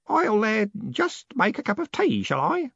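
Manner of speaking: high, hesitant offer; tentative old man. A narrator's reading. Grote offering tea after the scare, tentative.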